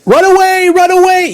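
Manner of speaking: Monty Python quote. Retold or imitated. Quoting Monty Python knights fleeing, high mock panic cry. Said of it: "If you're a Monty Python fan".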